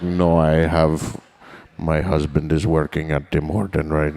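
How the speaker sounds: put-on low, slow Indian accent; Indian woman accent. Clerk turns down the drunk customer in a low, slow Indian accent. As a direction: turn him down matter-of-factly.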